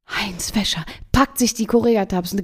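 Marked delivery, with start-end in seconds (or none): 0.1-0.8 retold grandma, whispering in a rage; whispered outburst; furious whisper. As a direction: hiss the name in a furious whisper. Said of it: "rastet kurz aus und sagt".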